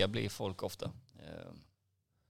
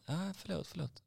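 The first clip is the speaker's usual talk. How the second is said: high, softening mock apology; small, high-pitched sorry, imitated; imitated sheepish apology; 10 semitones higher than usual. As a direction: back down meekly in the customer's high voice. Retold customer sheepishly apologising.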